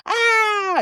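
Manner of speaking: sudden very high, loud shriek; mother acting out how she would react in a crisis, screaming; panicked scream. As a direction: burst into a scream of shock. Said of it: "Like, I'm gonna".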